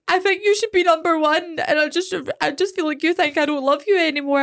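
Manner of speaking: whiny sobbing kid, hammed up; mock crying child. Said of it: "and then he starts crying".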